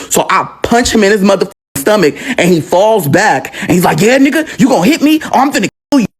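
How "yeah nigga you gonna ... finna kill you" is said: high, quick angry threat; acted-out menace, pitched up without shouting; retold threat. Uncle punched in the stomach threatens nephew, high angry threat.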